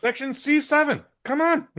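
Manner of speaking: very high-pitched summons; mock calling out. Riffing on calling the man back, high mock holler. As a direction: pitch it way up as if hailing someone.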